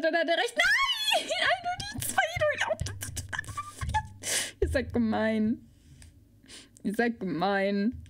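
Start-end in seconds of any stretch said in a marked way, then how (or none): 0.6-3.0 high laughing squeal. Acted. Laughing squeal at own slip. 4.6-8.0 mock sulk. Sulking at chat.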